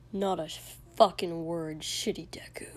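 low, hoarse whispered threat; angry whisper. A narrator's reading. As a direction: hiss it under your breath. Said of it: "Kotsky just said".

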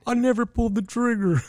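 high, far-off mocking voice; mock Alec Baldwin. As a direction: parody a whiny denial.

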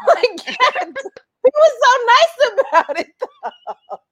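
laughing while saying it, voice high; giggling through the words. Real, not acted. Telling her friend the story, laughing high. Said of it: "That's hilarious".

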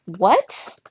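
sharp, loud rising what; shout. A narrator's reading. Trap shouts at Thea to get to the point, loud sharp question. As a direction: bark the question out. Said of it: "shouted Trap".

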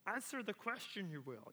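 Yoda voice with inverted words, playful; mock-wise sage, lightly voiced; Yoda-style mentor. Mentor [name] teasing Sean in a Yoda voice. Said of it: "in his Yoda ways".

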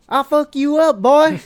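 very high, very loud bellowed threat; high shouted parody threat; mock Texan fan threat. Mocking Texas Rangers fan.

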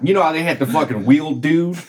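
ordinary talk

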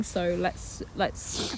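sinking to a near whisper; trailing soft plea. Retold or imitated. Friend voicing a gentle plea to the daughter, fading soft. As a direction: let the plea fade away. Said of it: "be like".

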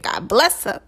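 ordinary delivery

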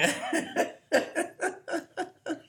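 breathy unvoiced laughter; airy laugh. Real, not acted. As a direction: laugh under your breath. Laughs on after pun.

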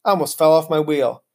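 plain reading voice

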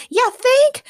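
very high, loud sarcastic scream. A narrator's reading. Morgana screaming at her cousin, sarcastic. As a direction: scream it back with scorn. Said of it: "Morgana screamed".

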